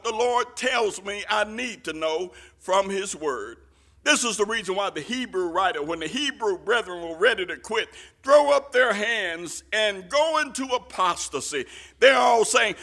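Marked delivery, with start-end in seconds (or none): none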